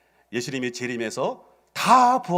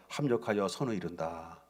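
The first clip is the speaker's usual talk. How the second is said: soft inner voice; 3 semitones lower, 9 dB quieter than usual. Retold or imitated. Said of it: "그런 마음을 주었어요".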